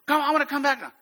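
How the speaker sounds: high, mock pleading; retold begging. Frightened student begging the instructor, high mock plea. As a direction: imitate a climber begging to be let down. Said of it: "they're begging me to let them down".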